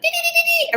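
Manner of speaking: very high squeaky babble; mock chatter. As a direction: babble like excited fans. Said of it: "they're, like".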